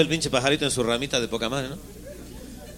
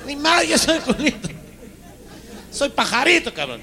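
shrill squeaky protest; 11 semitones higher, slower than usual. Acted. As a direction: squeal your denial. Little bird protests again.

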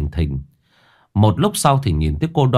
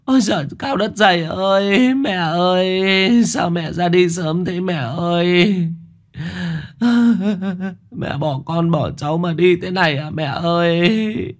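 high keening lament with a sob; bitter wailing; 10 semitones higher than usual. A narrator's reading. Daughter-in-law wails before the neighbours after the death, high keening. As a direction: wail out the grief again. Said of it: "tiếng khóc ... chua xót vang lên".

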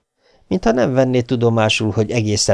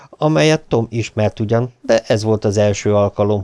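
plain reading voice

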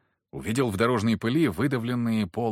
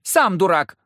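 put-on much higher, loud childish snap; angry retort; 9 semitones higher, 9 dB louder than usual. A narrator's reading. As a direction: hurl it back like a furious kid. Girl snaps back at leader.

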